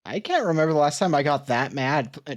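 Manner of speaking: normal talking voice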